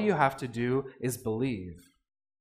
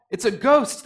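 higher, louder cry of fright; mock terrified cry; 8 semitones higher, 7 dB louder than usual. Disciples on the boat crying out in fright. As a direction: yelp it like you've seen a ghost.

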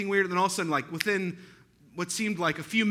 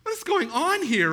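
loud, high cry of disbelief; bewildered loud exclamation at one thing after another; exasperated outcry; 11 semitones higher, 6 dB louder than usual. Retold or imitated. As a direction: throw it up high and loud like you can't take any more. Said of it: "I'm like".

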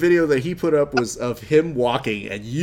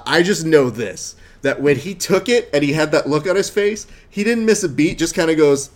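ordinary talk, nothing marked

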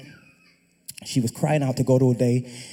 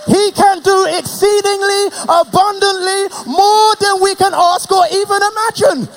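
full-force preaching; 10 dB louder than usual. Real, not acted. Preacher proclaiming God as provider to the congregation, shouting. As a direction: preach it to the rafters.

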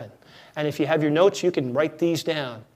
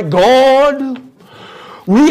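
loud, higher, solemn prayer voice, hammed up; the sudden God possession voice; mock prayer voice; 6 semitones higher, 9 dB louder than usual. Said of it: "and all of a sudden your voice changes".

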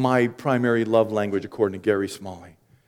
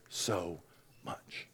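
usual speaking voice

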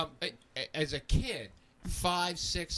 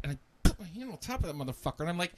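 usual speaking voice

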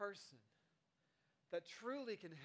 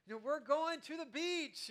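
higher, louder, gleeful; excited parent announcement; 7 semitones higher, 7 dB louder, slower than usual. Retold or imitated. Parent telling toddlers about the beach trip, excited. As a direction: announce the big surprise to the kids. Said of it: "you're like".

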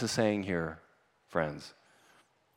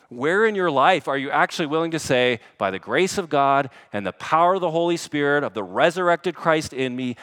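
loud, rousing pulpit declaration; forceful preaching; 5 semitones higher, 8 dB louder than usual. Real, not acted. Preacher asking who will stand against sin, forceful. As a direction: declare it boldly to the congregation.

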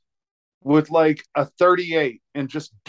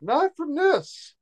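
mock panic; 7 semitones higher than usual. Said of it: "I was like".

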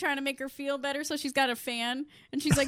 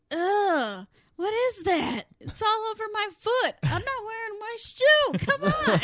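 high, louder disgusted whine; grossed-out whine; 9 semitones higher, 4 dB louder than usual. Retold or imitated. Retelling Becca's water breaking, high grossed-out whine. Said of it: "she's like".

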